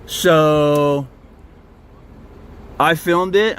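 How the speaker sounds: ordinary delivery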